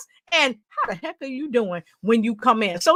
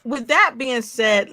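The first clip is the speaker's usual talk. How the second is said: ordinary delivery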